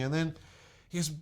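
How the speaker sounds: ordinary talk, nothing marked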